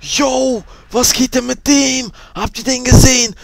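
very loud high excited yelling; excited shout. Real, not acted. Gamer shocked by burning zombie, excited yelling.

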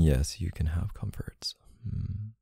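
tender murmur. Acted. Partner grants loved one some comfort, tender murmur. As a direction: hush it down to soothe.

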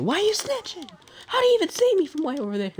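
squeaky high, fast and mocking; mock the snitch in a high squeal.

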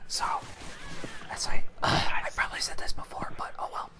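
soft whisper; whispering to viewers mid-game. Real, not acted. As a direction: whisper it so nobody hears. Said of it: "Don't wake the neighbors".

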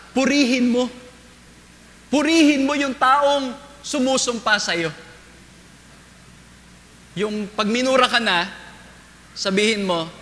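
ordinary talk

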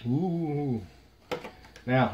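normal talking voice

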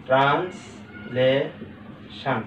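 "Translation" very slow, as trans, লে, শান; syllable by syllable. Shown as a demonstration. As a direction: clear pauses between the three parts of "translation". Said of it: "একসাথে আমরা বলতে পারছি trans".

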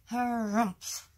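drawn-out grumbling harumph. A narrator's reading. Fizzle giving in beside the cradle, harumphing. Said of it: "He lowered his voice, too, and said".

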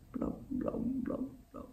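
whispered sinking bubbles; soft mud-sinking noise; bubbling blub. Acted. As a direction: breathe out each blub softly.

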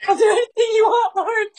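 high, loud sobbing pledge, hammed up; mock earnest vow. [name] recalling his eager vow to God, hammed-up sob. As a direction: overplay a teary over-eager promise.

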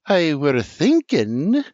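Sarah glaring, repeating herself pointedly; pointed, slow restart. A narrator's reading. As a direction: say it slowly, daring interruption. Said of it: "Boring holes through R J".

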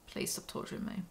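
whispered plea; begging the book to stop, whispered. Real, not acted. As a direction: breathe it out as a whisper. Groaning aside after reading.

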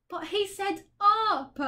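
high, slow whining protest; bitter complaint. A narrator's reading. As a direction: moan that it's unfair. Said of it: "Milo complained bitterly".

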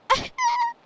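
loud, high burst of laughter. Real, not acted. Player amused by the game's joke, high loud laugh. Laughs after mock outrage.

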